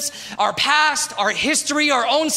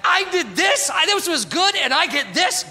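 exasperated complaint; 6 semitones higher than usual. Retold or imitated. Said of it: "I was just like".

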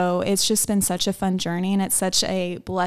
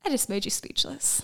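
playful hushed whisper; soft whispered tease to a friend; teasing whisper; 4 semitones higher, 8 dB quieter than usual. Real, not acted. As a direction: whisper it like a cheeky joke. Teasing friend after her sweet line.

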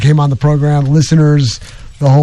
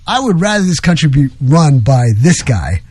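ordinary talk

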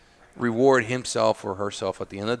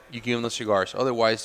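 ordinary delivery

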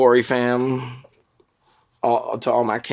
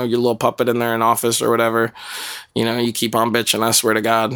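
ordinary talk

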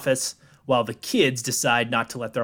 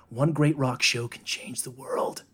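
whispered, buzzy lip effect; breathy lip-buzz whisper, slow; lip sound effect; 4 dB quieter than usual. Acted. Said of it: "What the fuck was that?".

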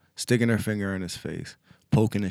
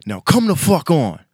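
mock angry order; 7 semitones higher, 6 dB louder than usual.

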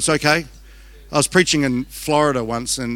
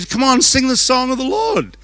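high, louder taunting mock; mocking captors; 9 semitones higher, 4 dB louder than usual. Captors taunting the exiles to sing, high mocking voice. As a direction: sneer it like a jeering captor. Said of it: "They went".

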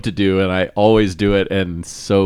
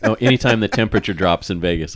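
usual speaking voice

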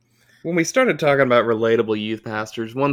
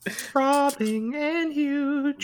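sultry drawl; 5 dB quieter, much slower than usual. Pretend. Mock sultry slow line.

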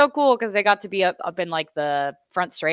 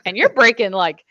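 rising, louder fatherly critique; critical dad; 7 semitones higher, 7 dB louder than usual. Retold or imitated. Dad critiquing daughter's braking in the pits, rising. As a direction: point out the braking gap. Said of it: "He's like".

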